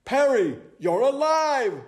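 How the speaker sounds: high, loud astonishment; owl's high-pitched surprise; amazed cry. Acted. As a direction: cry out in joyful disbelief. Owl finds his friend alive.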